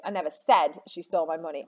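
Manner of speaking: "said" stands out from the rest; stress on SAID. Shown as a demonstration. Teacher shows students how meaning shifts, stressing "said". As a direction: hit "said" as the key word. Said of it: "I might have implied it".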